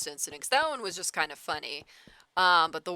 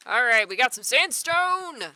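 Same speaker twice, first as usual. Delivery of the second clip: high, loud celebration; excited cheer; 7 semitones higher, 8 dB louder than usual. Real, not acted. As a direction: call it out triumphantly. Cheering a find in game.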